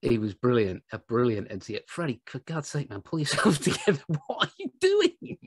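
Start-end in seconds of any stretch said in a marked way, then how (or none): 4.3-5.4 incredulous. Real, not acted. Teasing the sergeant.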